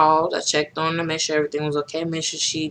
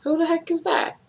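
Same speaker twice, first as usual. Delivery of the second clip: surprised; 11 semitones higher, 4 dB quieter than usual. Retold or imitated. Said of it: "I'm like".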